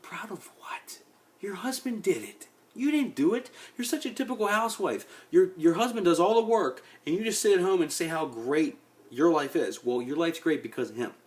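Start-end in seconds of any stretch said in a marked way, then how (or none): 0.0-1.0 a hushed, breathy scoff; mock whisper. Griping about an actress's pride in interviews, mock whisper. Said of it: "And I'm like". 1.4-9.8 higher-pitched scornful mock rant; mocking scold. Ranting at an actress about her husband's work, mocking higher scold. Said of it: "And I'm like".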